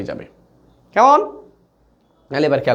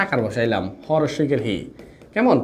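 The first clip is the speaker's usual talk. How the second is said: ordinary talk, nothing marked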